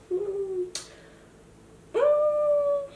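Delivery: high-pitched drawn-out "mm"; comic high humming; mock hum. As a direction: squeak out a held, high hum. Mimes people with over-blushed cheeks.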